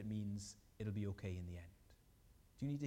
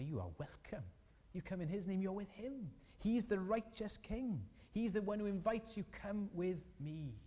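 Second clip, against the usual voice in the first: acted joyful welcome; 8 semitones higher than usual. Heaven's gates to the newcomer, joyfully welcoming. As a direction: lift the voice high, drawing out the ending.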